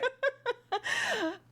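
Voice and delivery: high burst of helpless laughter; real laughter. Real, not acted. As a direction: crack up at your friend. Player cracks up at the table.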